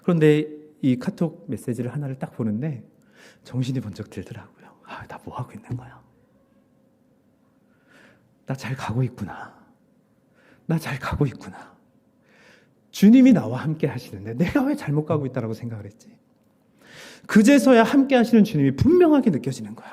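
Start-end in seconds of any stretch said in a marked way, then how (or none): 3.4-6.0 hushed realization. Real, not acted. Pastor confides his awakening to the congregation, hushed and soft. Waking up to God's presence. 17.3-19.9 much higher and louder, swept up in joy; excited preaching. Real, not acted. Pastor tells his church how the Lord's presence hit him, excited and loud.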